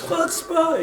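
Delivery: high shouted cry of outrage; screaming. Retold or imitated. Imitating a rabbi screaming at the council, outraged high shout. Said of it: "he kept screaming".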